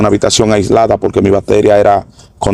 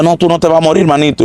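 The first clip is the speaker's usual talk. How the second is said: feverish reassurance; 7 semitones higher than usual. Retold or imitated. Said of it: "desperté dando gritos locos".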